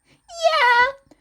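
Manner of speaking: high, loud cheerful yeah; excited yeah. Acted. Mei answering about her engine, high and loud. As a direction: burst out with it.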